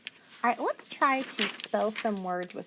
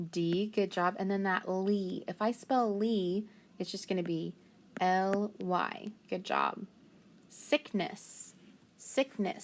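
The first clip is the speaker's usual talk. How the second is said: usual speaking voice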